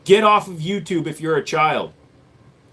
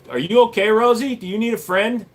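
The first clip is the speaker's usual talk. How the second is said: mock concern; 7 semitones higher than usual. Mocking a troll in chat.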